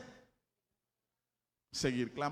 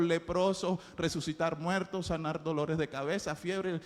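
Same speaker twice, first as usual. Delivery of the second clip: ordinary delivery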